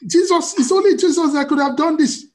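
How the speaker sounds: loud, high-pitched hammed-up disclaimer; mock humility. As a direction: protest with overdone humble awe. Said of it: "We try to be humble".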